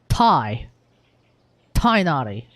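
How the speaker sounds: "Tainari" with first syllable as "Thai"; naive misreading. Retold or imitated. Streamer imitating readers who see T I G H, naive sounding-out. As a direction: sound out "Thai", then "Tainari", slowly piece by piece. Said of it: "They see T I G H and they say".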